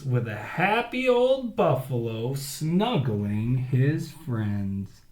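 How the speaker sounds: lively read-aloud storytelling; story narration. A narrator's reading. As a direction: read it big for the kids. Reads story to children.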